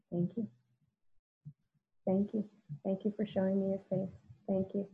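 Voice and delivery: soft, quiet thank-yous; tender thanks. Retold or imitated. Thanking her own pain in her mind, softly. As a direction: thank the pain gently, almost under the breath.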